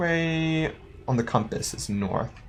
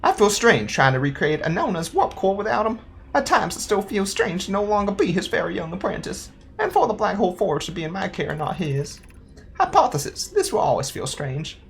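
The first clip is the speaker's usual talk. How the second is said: higher-pitched character voice; earnest young apprentice; 7 semitones higher than usual. Acted. Player voices an apprentice's log about a missing mentor, higher and wistful. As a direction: read it as an unsure apprentice, pitched up.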